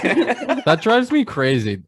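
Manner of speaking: laughing through the words; chuckling while complaining. Real, not acted. Laughing while complaining about dad.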